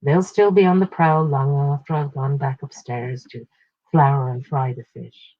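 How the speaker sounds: ordinary delivery, nothing marked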